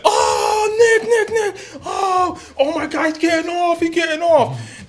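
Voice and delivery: very high-pitched imitation of screaming fans; mock frenzied cheering, high and strained; crowd going nuts. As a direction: squeal like a wildly hyped crowd. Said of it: "Everybody going nuts".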